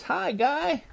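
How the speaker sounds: high, lightly voiced kangaroo hello; kangaroo's greeting. A narrator's reading. Kangaroo answers the new gator, high and lightly acted. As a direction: lift it high for a friendly animal's reply.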